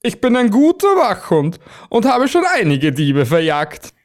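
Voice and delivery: a proud guard dog boasting to the archangel; proud watchdog. Acted. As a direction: puff up like a proud farm dog. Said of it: "sagte er voller Stolz".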